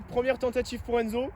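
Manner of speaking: live play-by-play, drawn-out cries; excited sports commentary. Acted. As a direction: call each shot like a commentator.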